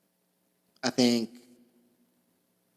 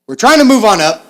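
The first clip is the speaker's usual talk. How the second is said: loud hammed-up cheer; 6 semitones higher, 12 dB louder, faster than usual. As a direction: belt it out, much louder and higher. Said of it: "got a little too into it".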